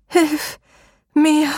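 high, rasping cry for help; a trapped woman's high croak; croaking plea. A narrator's reading. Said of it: "krächzte sie".

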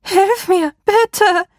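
high, loud desperate scream; panicked scream. A narrator's reading. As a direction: shriek in panic for help. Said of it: "die Schreie der Frau panischer".

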